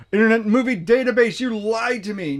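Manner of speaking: high, loud mock outrage; mock accusation. Host scolding a website for wrong trivia, mock indignant. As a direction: play betrayed, stretching out the blame.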